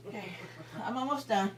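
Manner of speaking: usual speaking voice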